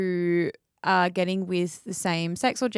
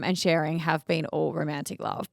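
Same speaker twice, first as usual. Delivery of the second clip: ordinary delivery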